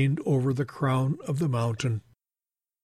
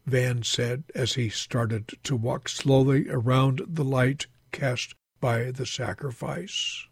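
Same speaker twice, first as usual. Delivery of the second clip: ordinary delivery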